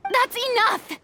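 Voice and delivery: high, firm call to stop; stern shout to end the fight; sharp command. Acted. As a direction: cut the quarrel off hard. Breaking up a quarrel.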